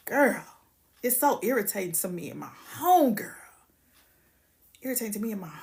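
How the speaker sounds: whispered, annoyed aside; whispered exasperation. Real, not acted. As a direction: hiss it like a shared complaint.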